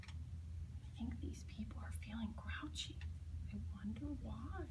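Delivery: storyteller's soft aside; whisper. A narrator's reading. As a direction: lean in and whisper it to the kids. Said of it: "aside to listeners, whispered".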